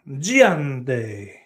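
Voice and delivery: GIANTE stretched in a put-on Spanish accent; Spanish-style coffee name. Streamer naming a special coffee, mock Spanish accent. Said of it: "It sounds like a special coffee".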